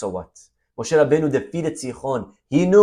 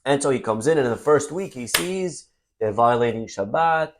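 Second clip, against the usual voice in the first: usual speaking voice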